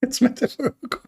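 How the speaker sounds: high, sneering sing-song; mock them by squeaking the excuse up high; scoffing high parody.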